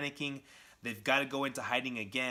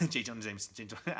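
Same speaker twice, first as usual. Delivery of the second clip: fast tongue-twister; much faster than usual. Shown as a demonstration. Reader failing at a tongue-twister name, fast and laughing. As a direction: rattle off "J. Jonah Jameson" through laughter. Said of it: "Say that fast ten times".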